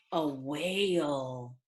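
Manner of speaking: ordinary delivery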